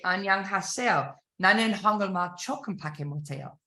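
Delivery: speaking Korean as a demo; spoken Korean. Shown as a demonstration. To sales learners, a short Korean phrase. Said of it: "You might say".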